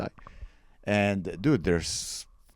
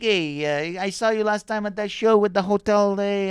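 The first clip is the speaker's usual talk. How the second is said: put-on raised-pitch Italian accent, calling out; Italian stranger; 8 semitones higher than usual. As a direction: imitate an eager Italian fan. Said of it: "being interrupted by two Italians".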